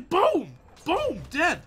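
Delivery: real excitement, high and loud; buzzing with adrenaline; adrenaline shakes. Real, not acted. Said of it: "My adrenaline's pumping. My hands are shaking".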